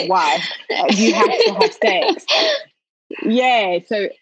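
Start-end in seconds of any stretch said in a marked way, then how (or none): 0.7-2.3 laughing. Real, not acted. Mocking expectation, laughing.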